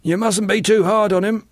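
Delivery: high-pitched excusing; high, superior character voice; condescending Gus. A narrator's reading. Gus excuses John to Clevers.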